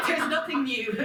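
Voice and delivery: real laughter through the words, high and loud; high-pitched, laughing protest. Real, not acted. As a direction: exclaim it loudly while cracking up. Laughing over her own retort.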